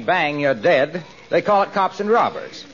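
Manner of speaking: normal talking voice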